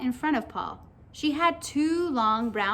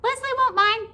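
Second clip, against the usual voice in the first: high, loud squeaky creature; loud high-pitched cartoon voice; little pigtail voice; 12 semitones higher, 6 dB louder than usual. A narrator's reading. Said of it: "said the pigtail".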